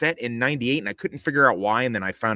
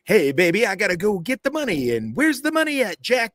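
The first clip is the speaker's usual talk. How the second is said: much higher, hammed-up breezy crook voice; whimsical movie gangster; 11 semitones higher, 4 dB louder than usual. Retelling the film's misleading trailer, high and hammed-up. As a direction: play a carefree caper-movie crook asking after the money. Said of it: "like a Big Lebowski type thing".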